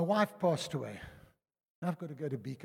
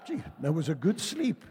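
laughing, slightly louder; amused thought; 2 semitones higher, 5 dB louder than usual. Retold or imitated. Pastor recalls waking in his chair, laughing. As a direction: chuckle at yourself.

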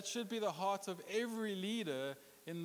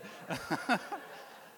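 silent wheezing laugh at his own pun; laughing at his own joke; breathy laugh. Real, not acted. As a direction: let out a breathless chuckle.

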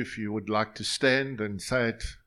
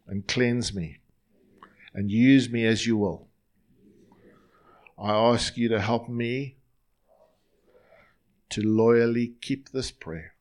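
louder, paced prayer line by line for the room to echo; slow prayer to repeat; 5 dB louder than usual. Shown as a demonstration. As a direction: lead the congregation phrase by phrase. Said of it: "I'm gonna say it slowly and just repeat after me".

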